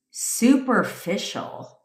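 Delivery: high, slow, drawn-out disbelief at the word Superficial; incredulous echo. Retold or imitated. Echoing the men's insult back in disbelief, slow and high. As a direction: stretch Superficial as if it's absurd. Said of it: "I'm like".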